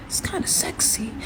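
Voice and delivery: a guilty little whisper. Retold or imitated. Herself confessing a crush, whispered. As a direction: whisper it so nobody hears. Secret aside about the gangster.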